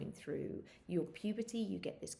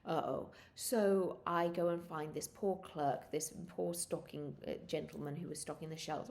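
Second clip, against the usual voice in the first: ordinary talk, nothing marked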